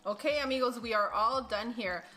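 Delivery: loud, upbeat recipe narration; cooking show host. Acted. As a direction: present the recipe like a TV cook.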